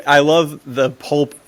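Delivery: ordinary talk, nothing marked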